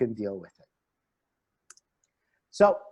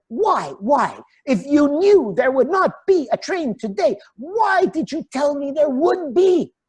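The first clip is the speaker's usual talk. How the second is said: exasperated demand; 12 semitones higher, 4 dB louder than usual. Retold or imitated. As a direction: demand an answer, high and loud, like a frazzled young traveller. Traveller loses his cool.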